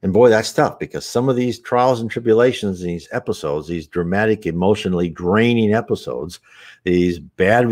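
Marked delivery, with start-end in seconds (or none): none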